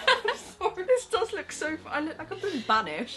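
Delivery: high laughing apology. Real, not acted. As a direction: laugh through the words. Laughing fit at how they look.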